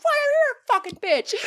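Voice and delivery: very high yell breaking into laughter; laughing angry yell. Retold or imitated. She yells back at the driver, laughing high yell. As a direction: shout back, half laughing. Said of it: "I'm like".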